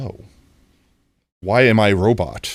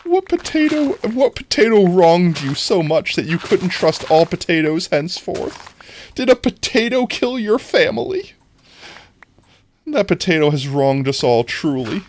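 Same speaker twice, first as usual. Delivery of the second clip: high, loud theatrical indignation; mock-dramatic outrage; 10 semitones higher, 5 dB louder than usual. Streamer teasing a potato-hater, mock-dramatic outrage. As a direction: plead the grievance with hammed-up passion.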